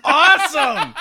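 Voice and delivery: high, very loud laughing cheer; delighted shout. Real, not acted. Co-host reacting to dad's tank story, delighted shout.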